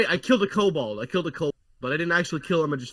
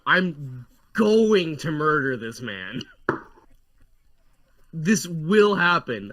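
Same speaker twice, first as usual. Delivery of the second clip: slow, deliberate threat; measured mock-menacing declaration; emphatic vow; slower than usual. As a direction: spell out each word as a vow. Player vows revenge after being insulted.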